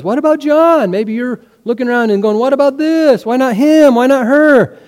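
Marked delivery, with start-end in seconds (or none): 0.0-0.9 higher, louder protesting question; arguing. Acted. Believer arguing with God like Peter, protesting. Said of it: "arguing like Peter was". 2.4-4.8 high, loud string of challenges; arguing. Acted. Believer looking around and challenging God, arguing. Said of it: "arguing like Peter was".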